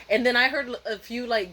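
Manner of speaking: ordinary delivery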